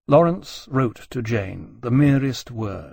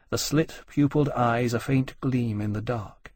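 usual speaking voice

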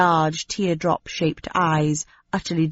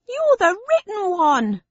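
much higher, slow jeering voice; high crowd voice; 14 semitones higher than usual. Acted. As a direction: jeer from the back of the crowd. Voice from back of crowd.

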